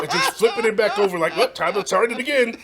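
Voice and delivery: high, loud and carried away; loud excited reminiscence. Real, not acted. Fan recalling flipping the one tape over, real excitement. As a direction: belt it out over the laughter. Animated memory of replaying tape.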